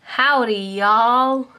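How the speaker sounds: a half-hearted cowboy Southern drawl; bad Southern US accent. Shown as a demonstration. Showing viewers her terrible Southern US accent. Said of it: "I'm just terrible at accents".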